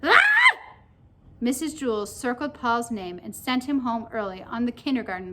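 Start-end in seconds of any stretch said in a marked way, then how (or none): 0.0-0.2 sudden high shriek; short, loud girl's scream. A narrator's reading. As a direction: shriek out of nowhere. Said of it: "Leslie screamed".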